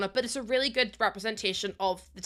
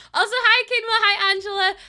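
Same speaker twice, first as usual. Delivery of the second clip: excited greeting; 9 semitones higher, 8 dB louder than usual. Real, not acted. Greeting chat viewers excitedly.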